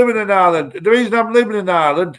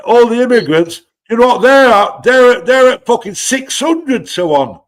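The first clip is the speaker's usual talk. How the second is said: loud rant; 7 semitones higher, 6 dB louder than usual. Real, not acted. Ranting caller raises voice.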